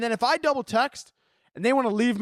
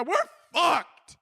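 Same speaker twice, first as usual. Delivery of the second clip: ranting cry; 7 semitones higher than usual. Real, not acted. Rant refrain.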